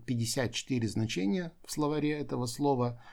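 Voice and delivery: ordinary delivery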